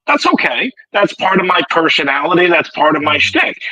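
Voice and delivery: ordinary talk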